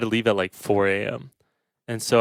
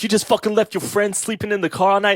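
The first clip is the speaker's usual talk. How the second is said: high, indignant New York cop; New York accent; 10 semitones higher than usual. Retold or imitated. Cop scolding him for leaving his friend, New York accent. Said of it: "I like your New York accent".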